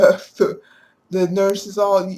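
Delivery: usual speaking voice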